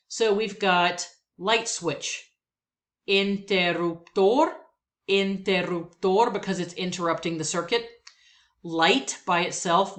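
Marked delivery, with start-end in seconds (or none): 3.1-6.3 interruptor said slowly, stress on TOR; interruptor, rising at the end; Spanish word. Shown as a demonstration. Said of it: "words that I will pronounce".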